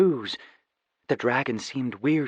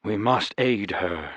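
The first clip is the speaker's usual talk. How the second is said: somber knight; 3 semitones lower, 3 dB quieter than usual. Acted. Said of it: "the Somber Knight said".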